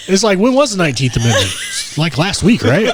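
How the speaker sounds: high, loud laughing sarcasm; giggling mockery. Real, not acted. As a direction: laugh through the joke loudly. Laughing joke about suffrage.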